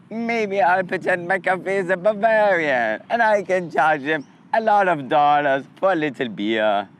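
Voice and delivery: scheming owner in a mock Italian accent. Italian owner planning to overcharge, mock Italian accent. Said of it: "maybe the owner was Italian".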